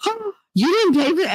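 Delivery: high-pitched gasp of disbelief; mock shocked. Mom retelling her reply to son who didn't pay, shocked. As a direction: gasp it, retelling your astonishment.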